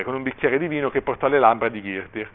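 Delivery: usual speaking voice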